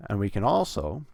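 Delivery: ordinary talk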